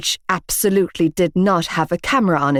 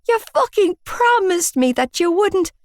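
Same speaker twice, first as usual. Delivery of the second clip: high, near-crying reproach; hurt cry at broken promise; tearful accusation; 12 semitones higher than usual. A narrator's reading. Tears welling, accusing.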